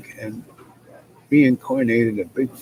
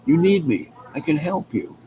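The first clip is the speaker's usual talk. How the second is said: crow voice; 6 semitones higher than usual. Acted. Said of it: "what the crow said?".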